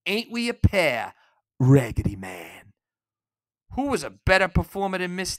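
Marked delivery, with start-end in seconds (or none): 0.1-2.7 a light take on the film line. Retold or imitated. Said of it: "What is that great line she has?".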